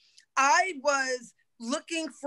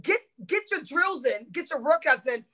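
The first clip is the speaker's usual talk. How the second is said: usual speaking voice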